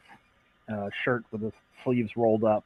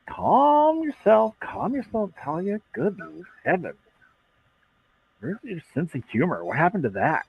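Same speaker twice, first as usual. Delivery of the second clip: mock jovial placating; 6 semitones higher than usual. Mechanic pushing blade away, jovial.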